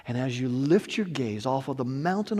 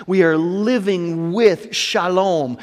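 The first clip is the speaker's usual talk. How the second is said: usual speaking voice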